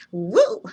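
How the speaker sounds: loud, high "woo"; excited whoop. Real, not acted. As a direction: let out a big whoop. Whoop after hyping herself up.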